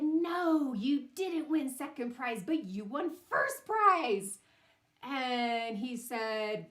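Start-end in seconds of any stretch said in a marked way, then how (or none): 0.0-4.4 higher, louder big reveal; Boy Scout leader breaking the happy news, excited; announcing good news. Retold or imitated. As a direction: build up to first prize.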